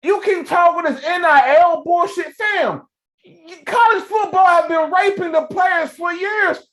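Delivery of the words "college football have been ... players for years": heated shout. Real, not acted. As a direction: shout it like a rally cry. Heated pay-the-players rant.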